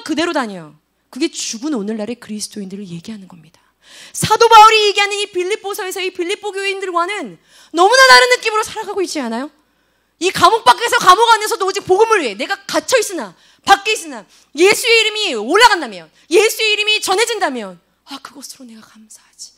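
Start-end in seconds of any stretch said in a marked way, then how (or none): 1.1-3.6 hushed whispered aside. Real, not acted. Preacher whispers the hard truth to students. 18.0-19.5 soft, hushed, thankful whisper; whispered gratitude. Retold or imitated. Preacher voices Paul thanking God softly, whispering. Paul's grateful murmur.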